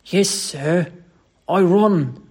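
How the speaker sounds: emphatic Cockney confession; Cockney dialect. Acted. Thomas admits to his employer he ran, emphatic Cockney. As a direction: own up in Cockney. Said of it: "Thomas' Cockney dialect".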